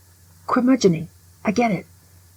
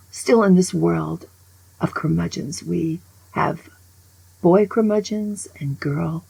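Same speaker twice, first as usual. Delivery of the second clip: ordinary talk, nothing marked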